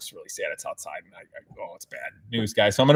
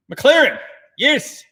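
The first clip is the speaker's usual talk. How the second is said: emphatic verdict; 11 semitones higher, much slower than usual. Pretend. Emphatic pick of the McLaren.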